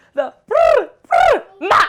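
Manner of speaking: loud, high vocal warm-up trills; Sharpay. Acted. Getting in character before a take, loud warm-up trills. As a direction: trill it out big like a diva warming up. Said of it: "What is it Sharpay does?".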